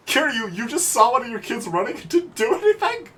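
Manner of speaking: loud, high, slow outraged yell; outraged slow shouting; indignant shout. Acted. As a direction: scold him for doing nothing. Scolding Kiryu for inaction.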